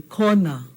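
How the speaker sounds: kona with a pure, unglided O; flat O. Shown as a demonstration. Teacher giving class an example word, flat O in kona. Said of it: "Flat O".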